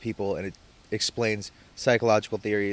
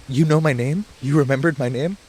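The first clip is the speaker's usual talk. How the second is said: excited disbelief; 5 semitones higher, 7 dB louder than usual. Retold or imitated. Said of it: "he's like".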